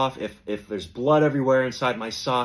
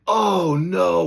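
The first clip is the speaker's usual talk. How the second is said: sympathetic groan; 8 semitones higher, 6 dB louder than usual. Retold or imitated. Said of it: "everybody was like".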